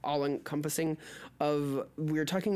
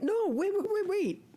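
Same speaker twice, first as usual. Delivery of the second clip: excited. Real, not acted. Panicking over the number order.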